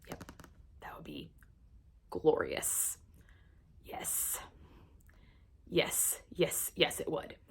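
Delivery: dreamy hushed whisper. Real, not acted. To viewers imagining Rhysand under the tree, a dreamy whisper. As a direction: whisper it, lost in fantasy.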